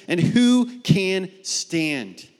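drawn-out, heavy plea; slow crying question. Acted. People before God's wrath, slowly asking who can stand. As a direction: stretch each word as if despairing. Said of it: "they're crying and they're saying".

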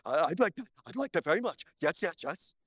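quick cartoon alien voice; Bugs Bunny alien. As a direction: put on a fussy little cartoon alien. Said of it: "alien on Bugs Bunny".

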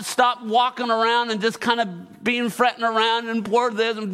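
rally the men, push it up; preaching at full force. Acted. Pastor rousing the men of the church, fervent preaching.

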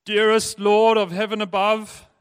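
hammed-up lofty prayer; pompous prayer. Dad praying formally at the table, pompous.

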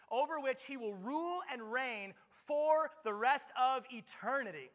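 high, loud, slowing pulpit preaching; preaching at full force. Acted. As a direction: drive the charge home to the congregation. Proclaiming Jesus' victory.

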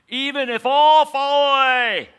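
hammed-up high shout, sustained to the end; emphatic vow. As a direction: belt it out as an over-the-top pledge. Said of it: "Peter was speaking emphatically".

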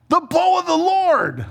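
high-pitched exclamation falling at the end; excited proclamation. Pretend. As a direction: cry it out as if pointing it out to everyone. Exclaiming it to witness to people.

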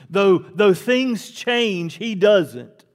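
loud, high, rising sermon climax; preacher driving home that God does not change; fiery preaching. Acted. Preacher to congregation, loud and high.

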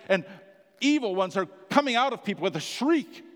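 fiery preaching. Acted. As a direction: build the crowd's amazement, loud and high. Preaching at full force.

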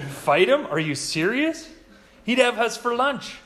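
incredulous, a little laugh, loud; scoffing soldier. Acted. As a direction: scoff at the idea. Jimmy the Gourd scoffs.

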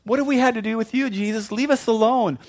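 high, loud shrieking demon; the demons begging Jesus, high and panicky; panicked demon voice. Retold or imitated. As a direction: cry out like frightened demons. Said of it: "there's an argument".

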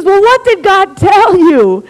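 loud, high, stretched-out question; exasperated demand. Retold or imitated. Husband prying God's answer from wife, exasperated demand. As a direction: insist slowly, at the end of patience.

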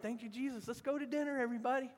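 high-pitched acted jubilation; celebrating. Forgiven debtor inviting everybody to dinner, celebrating. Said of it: "I mean, I wanna go celebrate".

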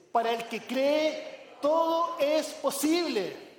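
slow, deliberate, rising on todo; with conviction. Shown as a demonstration. Pastor leading the congregation in unison, with firm conviction. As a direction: say it like you mean every word. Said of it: "pongámosle un poco de convicción a esto".

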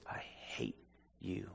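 hushed, voiceless hiss of hatred; menacing whisper. Retold or imitated. Demon speaking through Sean to the preacher, whispered. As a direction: breathe the threat in a demonic hush. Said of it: "He says".

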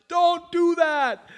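high, loud, slow warning; voice of conscience. Acted. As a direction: let conscience cut in sharply.

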